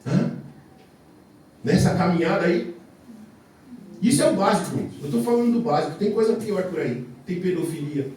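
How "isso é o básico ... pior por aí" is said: usual speaking voice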